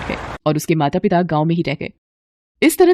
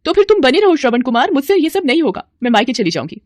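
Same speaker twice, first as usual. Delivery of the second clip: angry retort; 6 semitones higher than usual. Acted. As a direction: fling it back at him in anger. Wife shouting in quarrel.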